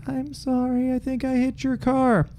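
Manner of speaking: sheepish woman. Retold or imitated. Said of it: "And the woman's like".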